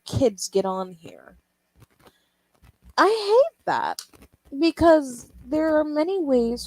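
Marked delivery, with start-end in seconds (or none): 3.0-6.6 high cracking voice. Real, not acted. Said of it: "my voice cracked".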